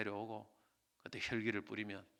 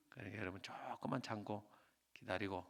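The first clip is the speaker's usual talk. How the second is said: soft closing exhortation; 4 semitones lower, 7 dB quieter than usual. Acted. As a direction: gently urge them to wait in faith.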